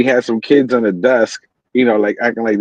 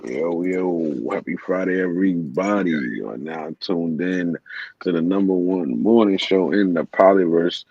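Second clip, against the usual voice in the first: radio host; 5 semitones lower, 5 dB quieter than usual. Pretend. Opens a morning radio show.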